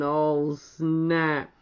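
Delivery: ordinary delivery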